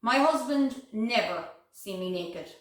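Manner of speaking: ordinary talk, nothing marked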